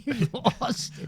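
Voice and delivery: high, laughing while talking. Real, not acted. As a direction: squeeze it out through laughter. Still laughing at the memory.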